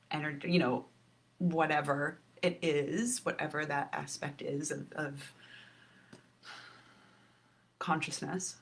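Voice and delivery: normal talking voice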